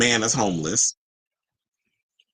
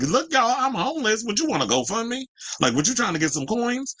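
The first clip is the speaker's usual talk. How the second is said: much higher, mock pleading voice; mocking the poster; 13 semitones higher than usual. Mocking a star begging followers online, in a much higher whiny voice. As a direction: parody her asking for money. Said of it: "it's just like".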